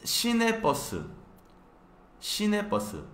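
"시내버스" mucho más alto y rápido; modelo de pronunciación. Shown as a demonstration. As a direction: canta "시내버스" como modelo. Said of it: "así es como pronuncia". (pronuncia "시내버스" aguda y rápida)

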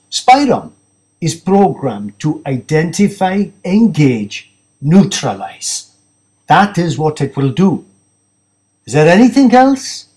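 proud of the machine, a touch removed from the narrator's own voice; hint of an aloof scientist; lightly voiced cold technologist. Narrator voices the same character.

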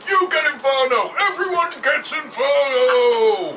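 high, loud gleeful shouting; hammed-up wizard shout. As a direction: yell it like a mad wizard. Wizard shouting game spells.

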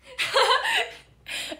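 high, loud burst of laughter; sudden shrieking laugh. Real, not acted. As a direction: crack up out loud.

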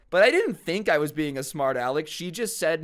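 ordinary delivery

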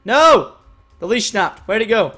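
loud, high cry of dismay. Real, not acted. Player whose horse leash just snapped, dismayed cry. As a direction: shout out at a sudden loss. Leash snaps after celebrating.

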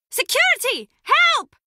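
high-pitched cry for help; calling for help. Acted. Calls out for security.

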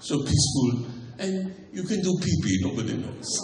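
mock French undersea documentary host; Jacques Cousteau. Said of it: "the undersea world of Jacques Cousteau".

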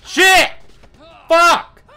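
screamed high curses; furious outburst. Real, not acted. Gamer screaming after failing, furious shout. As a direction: explode in real rage.

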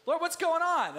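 loud, high, pleading demand; exasperated cry to God. Retold or imitated. Preacher crying out to God in frustration. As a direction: throw the question up at heaven. Preacher voicing his own complaint.

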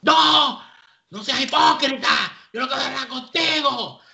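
erupting retold shout. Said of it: "empieza a gritar".

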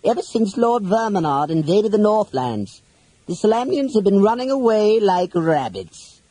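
high put-on villain voice; Toda. A narrator's reading. Captor mocking the knight's fleeing countrymen, in villain voice. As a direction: taunt them in a high character voice. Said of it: "Toda remarked".

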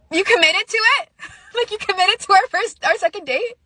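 high, disbelieving repeat of his words; can't believe he'd ditch her for the gym; incredulous outrage. Retold or imitated. Telling viewers how her date chose the gym, high outraged disbelief.

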